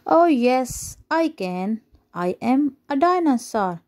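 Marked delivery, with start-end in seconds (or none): none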